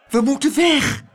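high, loud urgent gasp; breathless high-pitched warning; panting alarm. A narrator's reading. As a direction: gasp it out in panic. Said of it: "hijgde Abeltje".

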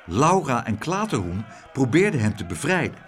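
plain reading voice

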